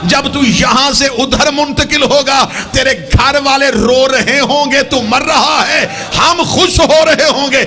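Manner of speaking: high, ringing sermon voice building to a climax; full-force preaching. Real, not acted. Preacher likening death to birth for congregation, full-force preaching.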